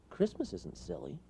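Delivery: high, soft little toad; small toad gently insisting; lightly voiced toad protest.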